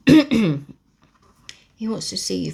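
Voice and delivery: slow, loud housekeeper voice; lightly voiced stern housekeeper. Mrs Medlock voiced by narrator.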